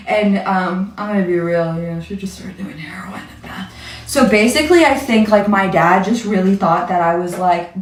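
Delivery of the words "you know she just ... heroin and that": confiding whisper, barely voiced; whispered aside. Real, not acted. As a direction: drop to a whisper to admit it. Confessing mom's drug use.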